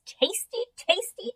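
tasty, tasty sung high with a rising lilt; sing-song. A narrator's reading. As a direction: relish each tasty like a treat. Hamster savoring the seeds.

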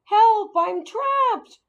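high, loud, drawn-out cry for help; slow, squeaky storybook shout; squeaked yell for help. A narrator's reading. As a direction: yell like a small trapped animal. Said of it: "I squeaked".

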